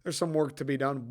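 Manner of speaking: usual speaking voice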